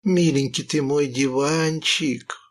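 ordinary delivery, nothing marked

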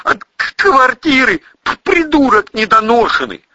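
high, loud stuttering insult; agitated high-pitched scolding with stammer; angry stammered outburst. A narrator's reading. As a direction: trip over consonants while raging. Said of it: "выпалил армеец".

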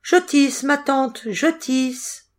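put-on high, light young girl's voice; small high-pitched reply; little girl answering. A narrator's reading. Little girl answers her aunt.

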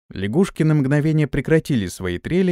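ordinary delivery

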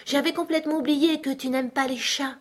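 put-on high little-girl apology; Alice apologising. Alice excuses herself to the mouse, high-pitched apology. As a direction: explain yourself in a high, contrite child's voice.

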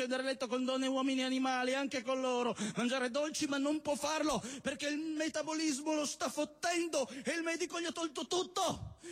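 usual speaking voice

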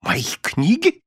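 bewildered echo, pitch rising; astonished. A narrator's reading. Wife echoing her husband's question, astonished. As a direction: repeat it back in surprise, going up. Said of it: "повторила миссис Корнер в изумлении".

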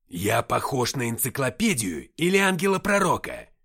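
loud, sneering rhetorical question; mock them loudly; mocking demon. A narrator's reading.

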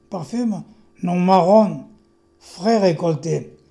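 slow, measured verse delivery with lifted emphasis; poem recitation. Acted. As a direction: recite your poem to the room, loud and deliberate. Recites own poem aloud. (récitation de poème, lente et bien scandée)

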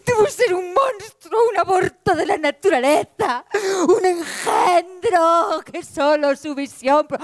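high, slow, tearful wail with a crying break; sobbing lament. Acted. Truth asking why people push her away, high tearful sobbing. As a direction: wail it like a rejected girl near tears. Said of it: "Y decía".

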